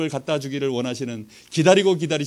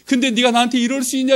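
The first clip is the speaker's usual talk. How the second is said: high, loud retold reproach; mother's reproach; 7 semitones higher, 5 dB louder than usual. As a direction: act out a mother's rising protest. Mother's reproach escalates, shaking him.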